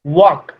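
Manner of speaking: "वॉक" said alone with a silent L; correct pronunciation. Shown as a demonstration. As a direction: model "वॉक" clearly, no L before the K. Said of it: "इसको पढ़ते हैं वॉक". ("वॉक" में ल को चुप रखकर)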